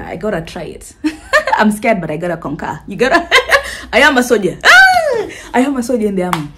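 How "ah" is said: high loud shriek; short scream. Pretend. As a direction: let out a sudden scream. Scream in the Fear Factor fantasy.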